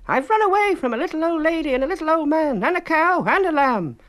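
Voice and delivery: very high, loud, taunting little voice; high loud singsong taunt; gingerbread man. A narrator's reading. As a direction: tease the chasers from far ahead.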